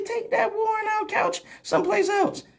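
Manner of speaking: very high squeaky send-off; high-pitched retort. Acted. Comic sending boastful women away, high squeaky retort. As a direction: dismiss her in a comically high voice.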